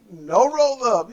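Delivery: whiny slow crying plea of a wrestler; mock crying plea. Retelling Yano begging Juice, mock crying plea. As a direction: wail it like a begging kid. Yano pleading not to roll up.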